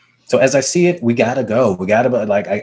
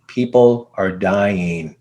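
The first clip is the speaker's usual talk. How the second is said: very slow, weighty repeat; slow and deliberate; 3 semitones lower, much slower than usual. Retold or imitated. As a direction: lay each word down slowly to make him think. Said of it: "I was like".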